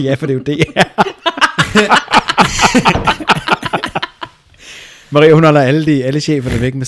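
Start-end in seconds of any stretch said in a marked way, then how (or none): 0.7-4.3 loud high burst of laughter; long real laughing fit; big laugh. Real, not acted. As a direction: crack up at the joke. Laughing at the singing story.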